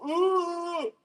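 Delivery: a high mock bodybuilder's stage grunt; mock posing grunt. Streamer mocks bodybuilders on stage, high mock grunt. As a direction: squeal it like a poser flexing. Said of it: "go on a stage and go".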